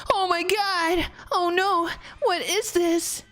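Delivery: high, frantic alarm at a horror; sudden terror, voice jumping up high; panicked cry. Acted. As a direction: shriek it out in panic. Game character panics in dream.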